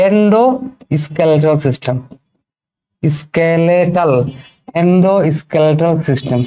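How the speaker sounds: very slow, each syllable of endoskeletal drawn out; slow dictation. Shown as a demonstration. Teacher dictates the term slowly for students to write. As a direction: spell the word out slowly for notes.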